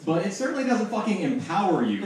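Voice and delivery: normal talking voice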